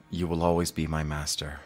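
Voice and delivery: soft, low pledge of loyalty; hushed sincere vow. Acted. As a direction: swear it privately to your old master. Pupil's private vow to master.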